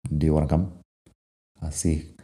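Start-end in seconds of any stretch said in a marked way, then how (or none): 0.1-0.7 "Do you wanna come" shortened and run together; connected speech. Shown as a demonstration. Teacher repeating the natural form for students, "Do you wanna" joined up. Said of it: "connected speech".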